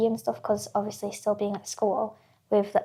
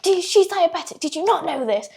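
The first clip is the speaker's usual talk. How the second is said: raised, higher mock-scolding doctor; retold shouting; 7 semitones higher, 6 dB louder than usual. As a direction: imitate a doctor shouting in disbelief. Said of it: "shouting at my mum".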